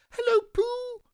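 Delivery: very high squeaky little creature; excited squeak. A narrator's reading. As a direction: squeak it out like a tiny excited child. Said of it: "he squeaked".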